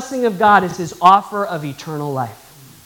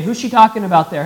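ordinary talk, nothing marked

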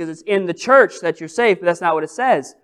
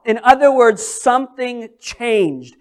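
ordinary talk

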